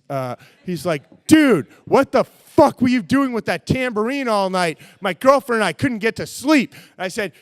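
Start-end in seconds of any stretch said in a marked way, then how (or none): 1.3-6.7 loud angry complaint; angry neighbor. Retold or imitated. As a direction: chew him out, loud. Pissed neighbor pounding on door.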